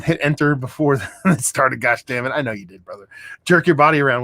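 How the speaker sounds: ordinary talk, nothing marked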